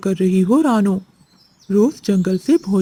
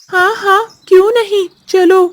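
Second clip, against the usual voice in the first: much higher, louder squawky parrot voice; chirpy parrot; 12 semitones higher, 7 dB louder than usual. Acted. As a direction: play the cheerful jungle parrot. Parrot character speaks.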